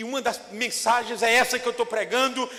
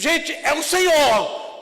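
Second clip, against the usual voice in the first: loud, slow, high cry of recognition; excited announcement; 8 semitones higher, 9 dB louder, much slower than usual. Acted. Peter shouting to the disciples, loud and overjoyed. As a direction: call it out big and slow, overjoyed. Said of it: "disse pros discípulos".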